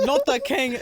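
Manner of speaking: loud, high-pitched mocking jab; mock outrage. Commenters roasting her pet name for boyfriend, mock outrage. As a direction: imitate a scandalized commenter.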